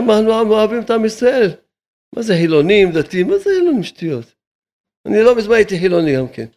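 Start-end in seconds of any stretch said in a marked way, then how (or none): 3.3-3.8 high mocking voice. Rabbi scoffing at those arguing over two hundred shekels, high and mocking. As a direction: jump way up into a high, incredulous mocking pitch.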